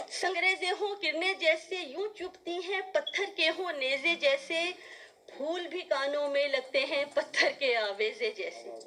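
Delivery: high-pitched, impassioned mushaira verse; declamatory Urdu nazm recital; poetry recitation. Acted. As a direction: build each repeated line for the audience. Recites her poem.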